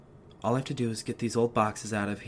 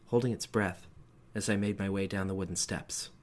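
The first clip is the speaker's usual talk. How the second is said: usual speaking voice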